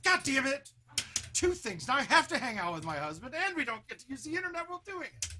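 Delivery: imitating wife's loud complaint, teasing; mock exasperated rant. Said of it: "she's like".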